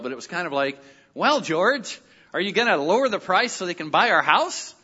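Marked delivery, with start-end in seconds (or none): 1.1-4.7 put-on a rough, higher, louder East Coast accent. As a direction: play the East Coast wife nudging her husband, East Coast accent. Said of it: "in her East Coast accent, which I can't do very well".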